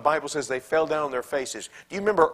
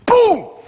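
loud, high explosive boom; sudden shout; 14 dB louder than usual. Acted. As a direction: burst out like a blast. Sudden anger bursts out.

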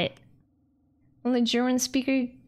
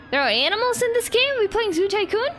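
astonished to find animals; surprised; 9 semitones higher, faster than usual. Real, not acted. Gamer finds animals in the game, high and amazed.

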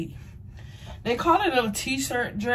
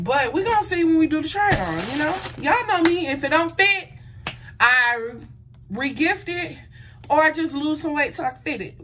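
playful; 6 semitones higher than usual. Real, not acted. Playful boast, high pitch.

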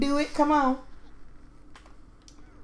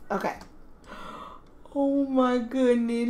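ordinary talk

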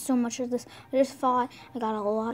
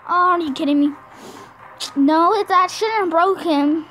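loud, disbelieving rant; loud furious disbelief; angry yelling; 4 semitones higher, 10 dB louder than usual. Real, not acted. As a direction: yell at the game in frustration. Raging at the game result.

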